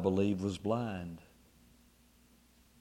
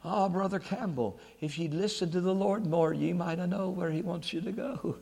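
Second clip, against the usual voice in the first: attempted Scottish accent; 4 semitones higher, 4 dB quieter than usual. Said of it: "don't have a good Scotch stick".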